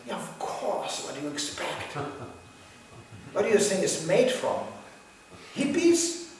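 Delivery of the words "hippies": mock surprise. Real, not acted. Rhetorical punchline.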